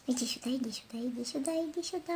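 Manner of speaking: coaxing whisper to a dog; whispered calling. Real, not acted. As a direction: softly coax the pet over. Тихо подзывает собаку. (шёпотом подзывая)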